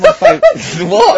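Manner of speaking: high laugh breaking into a what; laugh, then ask what. Real, not acted. Host laughing at the bear joke, high laughing what.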